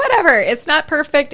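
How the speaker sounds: high, loud, breezy dismissal; dismissive. Retold or imitated. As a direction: brush it off with a shrug. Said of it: "I'm just like".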